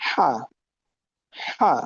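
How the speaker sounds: হা with air pressed through the back of the throat; pressed throaty h. Shown as a demonstration. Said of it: "তখন উচ্চারণটা হয়ে গেল". (গলা চেপে বাতাস ছেড়ে হা)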